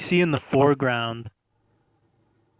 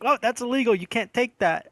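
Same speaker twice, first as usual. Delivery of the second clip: higher, mock-alarmed scolding; shocked wife; 9 semitones higher than usual. Wife catches husband taking neighbor's wire, mock alarm. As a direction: imitate a startled wife objecting. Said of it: "And she's like".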